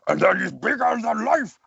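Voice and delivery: big, high-pitched Russian bellow from the diaphragm; Heavy. Shown as a demonstration. Voice actor demonstrating Heavy at full size, from the diaphragm. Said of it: "then you use a diaphragm".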